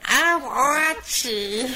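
very high squeaky voice. Retold or imitated. Told to keep the voice, a strained high squeak. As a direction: reach way up for the voice. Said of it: "in the same voice, say, Oh, Archie".

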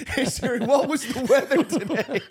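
laughing hungover ask. Pretend. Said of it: "retold bedtime query".

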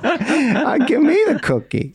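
put-on high, whiny kid begging; whiny child voice. Echoes the childish cookie quote.

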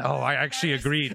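ordinary talk

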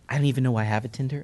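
ordinary talk, nothing marked

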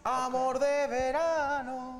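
mock-romantic swoon, stretched long; dreamy mock-romantic. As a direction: sigh it out like a love song title. Teasing about a summer romance.

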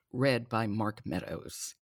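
usual speaking voice

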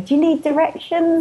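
high, eager helpful stranger; lightly voiced friendly New Yorker. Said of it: "people would always stop me on the street going".

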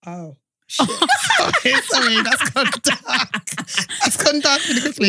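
helpless laugh while apologising; slow words broken up by laughter; cracking up. Real, not acted. Cracks up reading a dark dilemma.

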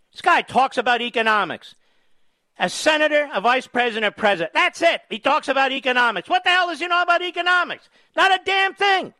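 builds to a high angry yell; rising outrage. Real, not acted. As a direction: climb from scornful to furious. Ranting at a caller.

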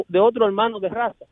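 usual speaking voice

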